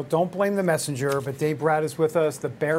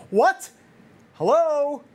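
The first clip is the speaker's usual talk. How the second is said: surprised; 8 semitones higher, 6 dB louder, slower than usual. Real, not acted. Shocked reaction to headline.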